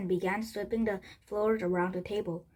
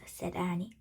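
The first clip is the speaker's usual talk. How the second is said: ordinary delivery, nothing marked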